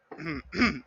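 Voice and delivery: clearing a tickly throat. Real, not acted. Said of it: "I'm choking on something".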